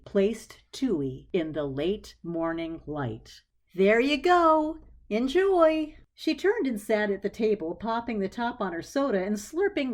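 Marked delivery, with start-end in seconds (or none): 3.8-6.0 high, slow, gentle presentation; tender offering. A narrator's reading. Clarissa setting Tui in the sunlight, high and tender. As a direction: hand it over ceremoniously. Placing plant ceremoniously.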